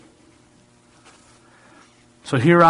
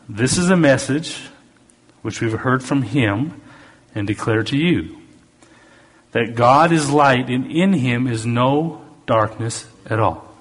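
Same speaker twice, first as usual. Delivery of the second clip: slow, emphatic preacher's reading; scripture reading; 2 semitones higher, slower than usual. Acted. Preacher rereading verse five to his congregation, slow and emphatic. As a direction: proclaim it deliberately, stretching the key words.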